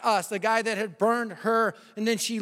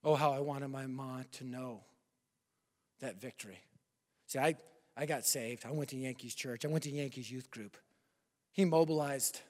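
much softer, lower personal confession; tender and hushed; 6 semitones lower, 9 dB quieter than usual. Acted. Preacher turning from the climax to his mother, soft and tender. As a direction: draw them in close and quiet.

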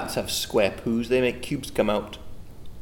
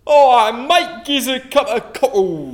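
cockney customer, much higher and louder; London accent; 11 semitones higher, 9 dB louder than usual. Pretend. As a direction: shout your order like a Londoner.

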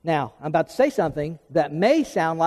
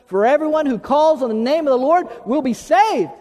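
raised, higher congregational recitation; out loud; 6 semitones higher, 4 dB louder than usual. Real, not acted. Pastor leading the church in the verse, out loud and rousing. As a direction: lead the crowd in saying it out loud. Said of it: "y'all say that out loud with me".

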